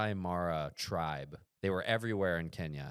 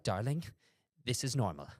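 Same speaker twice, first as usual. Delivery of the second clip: lightly voiced British lady, raised pitch; calm British grandma; 5 semitones higher than usual. British grandma to grandson during checkpoint trouble, calm. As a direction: reassure like an unflappable British grandmother. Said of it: "British, like, keep calm, carry on".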